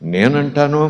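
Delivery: ordinary talk, nothing marked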